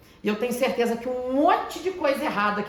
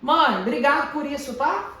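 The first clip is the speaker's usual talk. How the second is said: louder, higher cheerful thanks; grateful thanks; 5 semitones higher, 6 dB louder, slower than usual. Retold or imitated. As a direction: thank mom with bright enthusiasm. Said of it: "a gente tem que agradecer". (agradecendo a mãe, mais alto e animado)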